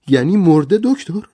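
high, loud stunned disbelief; shocked cry. Acted. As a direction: cry out as it sinks in that she is dead. Rogers hears wife is dead. (فریاد بلند و زیر از ناباوری)

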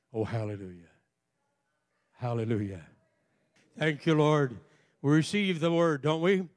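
ordinary talk, nothing marked

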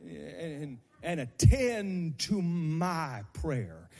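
loud, half-sung pleading, climbing to a shout; organ-backed preaching crescendo; whooping preacher. Acted.

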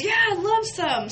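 high, pleased acceptance; eager singer. Retold or imitated. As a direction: play the singer gladly accepting. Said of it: "he goes".

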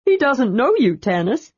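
high, eager, bubbling voice; bursting to tell, pitched high; barely contained excitement. A narrator's reading. Tasselhoff to Tanis about the knight, barely containing excitement. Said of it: "barely able to contain his excitement".

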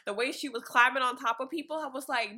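normal talking voice

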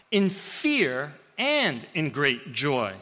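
each word laid down slowly; slow emphatic repeat. Real, not acted. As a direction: let the phrase sink in, slow. Preacher repeats phrase for weight.

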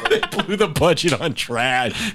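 slow, laughing through the words; chuckling while repeating the joke. Real, not acted.

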